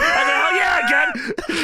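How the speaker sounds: loud, high-pitched fan's answer; excited fan shout. Retold or imitated. Fan answering Booker's catchphrase, excited shout. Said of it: "I go".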